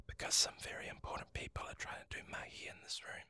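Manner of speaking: hushed whispered explanation. Acted. Dad explaining to Hine at the door, whispering. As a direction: keep it to a secret whisper.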